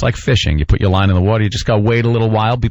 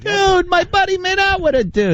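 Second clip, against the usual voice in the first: very high, squealing bro voice; hammed-up amazed buddy relaying big news; mock excited gossip. Comic acting out a buddy spreading the make-out story, mock excited.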